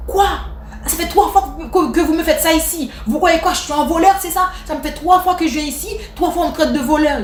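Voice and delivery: high, indignant mock rant from an offended shopper; angry customer. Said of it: "le monsieur s'énerve".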